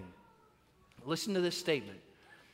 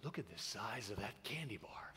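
whispered, awestruck announcer voice; imitating a kid's hushed announcer voice; radio announcer; 9 semitones lower, 15 dB quieter than usual. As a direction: whisper it like a late-night radio host. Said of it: "voice turned into a radio announcer".

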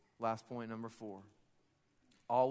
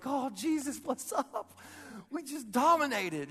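high, loud, gushing angel greeting; over-the-top celebratory hype; excited Gabriel; 11 semitones higher, 6 dB louder, slower than usual. Acted. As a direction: burst out like a thrilled buddy. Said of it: "he's just so excited".

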